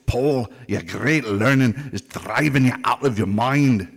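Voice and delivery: slow, booming loud proclamation. Acted. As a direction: call it out slow and loud across the court. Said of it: "Festus said with a loud voice".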